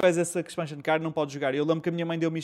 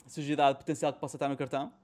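normal talking voice

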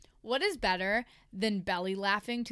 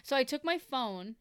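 ordinary delivery

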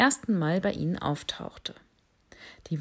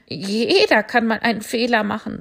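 put-on louder, higher young goat, forgiving; goat kid voice; 4 semitones higher, 8 dB louder than usual. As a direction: kid goat sticking up for the bat. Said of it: "sagte das Ziegenkind". (lautes, versöhnliches Ziegenkind)

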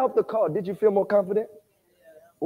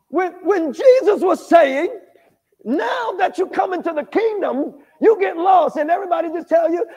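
ordinary delivery

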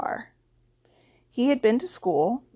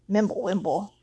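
ordinary delivery

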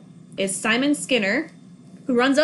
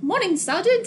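a higher, sing-song Minnesota Morning, Sergeant with rising end; Minnesotan greeting; 8 semitones higher than usual. Retold or imitated. Greeting the sergeant the Minnesota way, sing-song. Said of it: "They're not Minnesotans. We're like".

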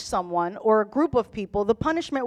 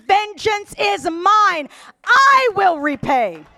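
slow, raised, drawn-out proclamation; booming divine declaration; 7 semitones higher, 5 dB louder, slower than usual. Retold or imitated. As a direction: proclaim it as God, loud and deliberate. Declaims God's words.

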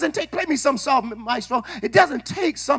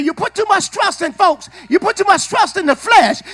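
ordinary talk, nothing marked

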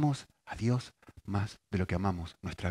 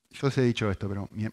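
ordinary talk, nothing marked

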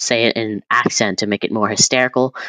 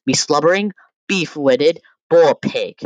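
higher, theatrical Shakespearean insult in an accent; exaggerated mock Shakespearean accent; put-on accent; 6 semitones higher, 4 dB louder than usual. Shown as a demonstration. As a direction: hurl it in a hammed-up accent, each part lifted. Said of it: "See, I added an accent".

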